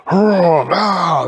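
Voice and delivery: loud, high "oh no"; alarmed outcry. Acted. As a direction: cry out in outrage. Ents angry about twig snapping.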